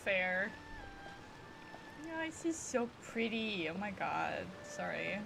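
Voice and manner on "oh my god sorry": usual speaking voice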